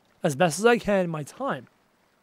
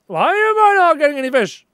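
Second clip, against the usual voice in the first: high, louder frustrated whine; 7 semitones higher, 5 dB louder than usual. Real, not acted. Streamer complains to chat about no fish, frustrated. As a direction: complain at the game.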